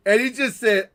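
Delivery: ordinary talk, nothing marked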